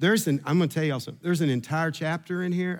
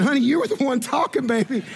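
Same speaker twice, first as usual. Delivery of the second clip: higher, quick teasing; husband teasing his wife, higher and quick; teasing reply; 9 semitones higher, faster than usual. Retold or imitated. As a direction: needle her playfully.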